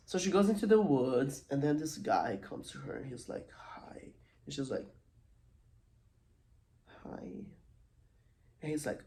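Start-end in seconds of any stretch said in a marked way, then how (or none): 3.5-4.1 whispered, creepy-stranger hello; hushed greeting. Retold or imitated. Stranger greets girl in the woods, whispered. 7.0-7.4 soft, hesitant little hello; hushed reply. Retold or imitated. Girl answers the stranger in the woods, softly.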